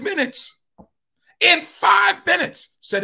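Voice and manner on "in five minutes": slow, high, loud stress on five; emphatic. Real, not acted. Preacher marvelling at the speed, slow emphatic stress. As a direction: stretch five minutes out.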